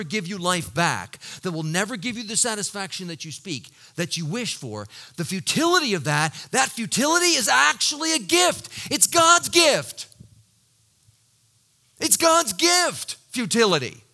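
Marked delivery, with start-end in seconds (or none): 5.2-14.0 preaching loud. Real, not acted. Preacher building to climax.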